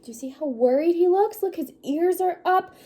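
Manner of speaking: ordinary delivery, nothing marked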